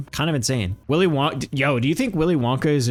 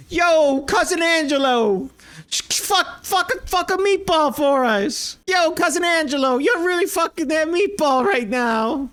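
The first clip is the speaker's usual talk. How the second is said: hollered mock Italian-guy hype, pitched way up; Costco guys impression; 13 semitones higher than usual. Mocking the Costco guys' videos, high hyped-up yelling. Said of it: "videos that are like".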